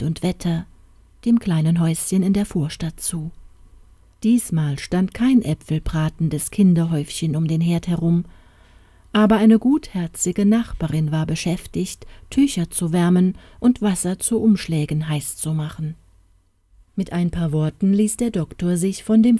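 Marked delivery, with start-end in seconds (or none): none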